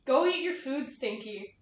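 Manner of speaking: soft cooing pet talk; cat voice. Real, not acted. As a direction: coo at the cat gently. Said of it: "Cat voice on stream".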